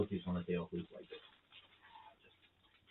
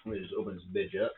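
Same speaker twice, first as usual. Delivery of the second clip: usual speaking voice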